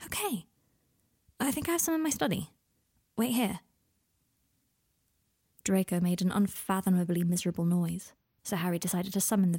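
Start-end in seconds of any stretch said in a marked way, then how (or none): 0.0-3.6 gentle soothing. Acted. Harry calming a frightened Draco, gently soothing. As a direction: talk him down like a spooked horse. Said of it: "as though talking to a spooked horse".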